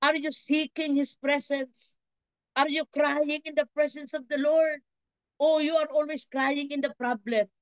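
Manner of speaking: high-pitched, insistent preaching; impassioned preaching. Real, not acted. Preacher challenges congregation on where the Lord is, impassioned. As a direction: drive each repeated challenge home, pitch held high.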